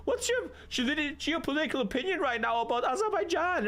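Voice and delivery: high-pitched, mocking babble; obnoxious date. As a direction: mimic an annoying date firing off questions. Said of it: "And you're like".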